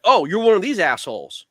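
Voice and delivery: mock-angry scoff, replaying the insult; snapping at a rude boater on the water, mock anger; retold telling-off. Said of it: "chewing him out".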